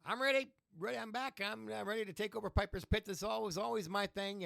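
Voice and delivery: high, loud brash Rowdy Roddy Piper voice; Piper impression. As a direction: bark it like a cocky wrestler reclaiming his show. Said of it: "just says".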